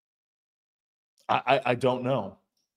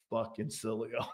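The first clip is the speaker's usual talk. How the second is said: very soft, lower muttered curse; 4 semitones lower, 12 dB quieter than usual. Retold or imitated. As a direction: grumble it under your breath. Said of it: "the first thing I thought of was".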